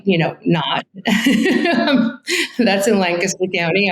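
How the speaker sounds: ordinary talk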